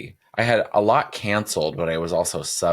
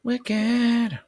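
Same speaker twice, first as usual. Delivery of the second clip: high squeal; 3 dB louder than usual. Acted. Joking about Wicked musical.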